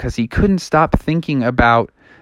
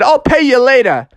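higher put-on ballplayer voice; lightly voiced big shot, higher; offhand star player; 9 semitones higher, 3 dB louder than usual. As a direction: toss it off to the vendor, pitched up. Star player with mouth full.

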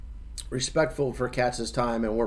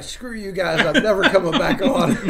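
laughing quote; 5 semitones higher, 3 dB louder than usual. Retold or imitated. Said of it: "He's like".

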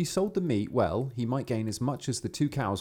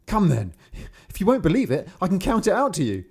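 louder, higher peasant daring the frogs; challenging shout; 8 semitones higher, 6 dB louder than usual. Acted. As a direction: throw down the challenge.

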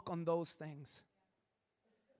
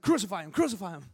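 mob shout; 8 dB louder, faster than usual. Retold or imitated. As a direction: cry it out like the crowd at the trial. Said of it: "you're like".

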